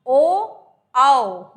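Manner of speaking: ओ and आओ called out loudly from the throat; loud vowel demo. Shown as a demonstration. Teacher demonstrating throat and lips together, loud. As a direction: voice ओ, आओ loudly with open mouth. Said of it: "लेकिन अंदर की तरफ से हवा आती है".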